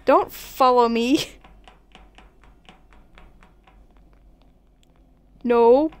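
slow, loud no; loud warning. Real, not acted. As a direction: warn them off firmly. Warning player in game.

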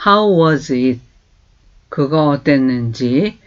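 ordinary talk

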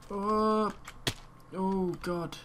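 high, drawn-out cry of alarm. Real, not acted. Gamer nearly dying in the game, alarmed. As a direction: yelp as you nearly fall.

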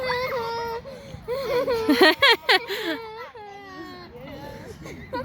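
loud breathless laughter; laughing fit. Real, not acted. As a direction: laugh hard while spinning. Dizzy on the swing, laughing.

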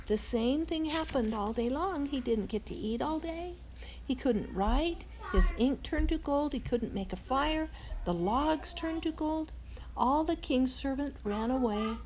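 ordinary delivery, nothing marked

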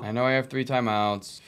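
ordinary talk, nothing marked

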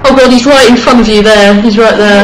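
loud fast warning; urgent shout. Real, not acted. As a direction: yell the warning. Urgently warning friend of monster.

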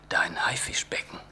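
soft, secretive whisper; hushed reveal. Acted. Father promising his son a tank, hushed.

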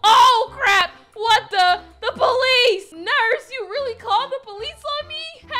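loud, high alarmed cry; loud dismay at the nurse's betrayal; alarmed shout. Acted. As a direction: yell in shock at the police. Police arrive.

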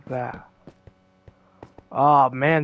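ordinary talk

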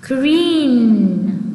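"Green" with a long drawn-out ee; long vowel. Shown as a demonstration. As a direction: stretch the ee in "Green". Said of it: "길게 발음하는 것을 표현합니다". ("Green"의 모음을 길게 늘여서)

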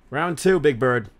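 taunting shout. Acted. Taunting the bird chaser.

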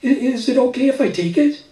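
put-on high, hesitant child voice; a shy girl asking permission; little girl voice. A narrator's reading. Little girl asks shyly.

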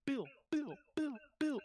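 high playful whisper. Pretend. Calling a teammate playfully.

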